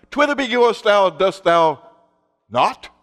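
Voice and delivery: loud mock-Elizabethan pulpit delivery; hammed-up archaic church speech; Elizabethan preacher. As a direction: declaim it like an old-fashioned English preacher. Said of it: "everybody spoke Elizabethan English in church".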